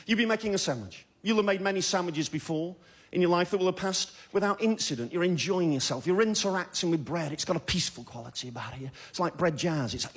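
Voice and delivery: normal talking voice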